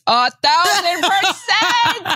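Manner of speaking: high, slow, laughing gush; drawn-out delighted squeal; joyful cry. Real, not acted. Gushing about pregnancy breasts.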